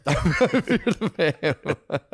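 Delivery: drawn out slowly through real laughter; can't get the words out for laughing; a slow, giggling repeat of the joke. Real, not acted. Echoing the joke while cracking up.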